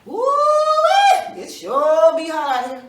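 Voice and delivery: high, loud whoop then loud complaint; loud woo-wee complaint. Acted. Rabbit complains to Bruh Man of the heat, loud. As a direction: howl about the heat again. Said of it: "said it again".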